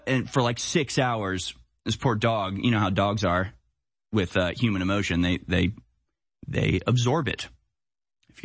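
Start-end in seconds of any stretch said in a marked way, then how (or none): none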